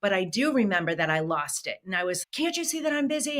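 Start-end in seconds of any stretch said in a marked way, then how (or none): 2.2-3.4 mock yell, much higher and fast; retold snapping. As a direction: replay losing it at the toddler. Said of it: "I started yelling at this poor little child".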